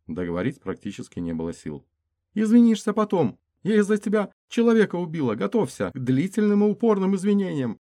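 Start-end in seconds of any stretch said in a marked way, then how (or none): 2.3-7.8 much higher, louder tearful scolding; high female voice. Acted. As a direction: scold him through fear. Woman scolds through fear.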